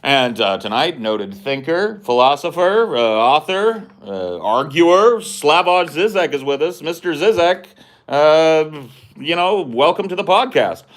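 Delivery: halting host intro with stretched-out filler words; podcast host intro. Acted. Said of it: "at the beginning of an interview".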